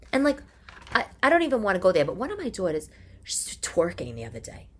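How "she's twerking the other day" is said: whispered, a bit scandalised. Real, not acted. Mother whispering to listeners about her daughter twerking. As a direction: drop to a whisper like it's a secret.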